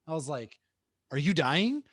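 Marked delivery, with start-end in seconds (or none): 1.1-1.8 retold panicked disbelief, much higher and louder; mock alarm. Dad jokingly alarmed at his son's bucket list, mock panicked. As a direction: play up the shock of a dad hearing a bucket list.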